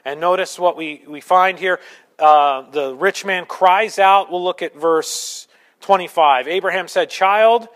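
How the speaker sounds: loud, driving sermon delivery; preaching at full force. Acted. Preaches rich man story forcefully.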